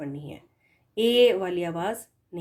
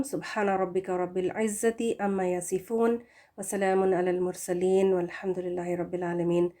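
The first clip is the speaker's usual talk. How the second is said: closing prayer; 3 semitones lower, 4 dB quieter than usual. Acted. Teacher closes the class with recited verses. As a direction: murmur the closing verses reverently.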